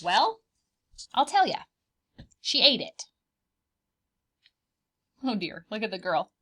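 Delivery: ordinary delivery, nothing marked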